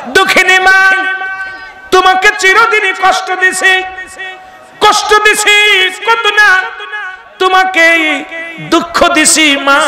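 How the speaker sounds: high, slow shouted grief; wailing lament. Acted. The prophet cries to his dead mother, high and wailing. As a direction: wail it like a son who failed his mother.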